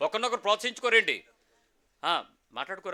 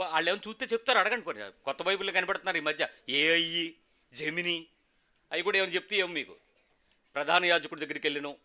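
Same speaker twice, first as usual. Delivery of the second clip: normal talking voice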